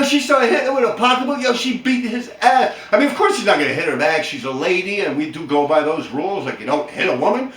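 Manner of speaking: ordinary talk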